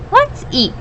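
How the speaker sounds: high, eager little goat. A narrator's reading. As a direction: squeak it out like a tiny goat. Little goat voiced in story.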